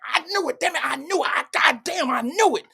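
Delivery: hammed-up eureka shout; triumphant outburst. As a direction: leap up crowing that you were right all along. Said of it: "wanna jump up and be like".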